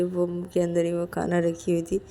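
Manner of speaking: usual speaking voice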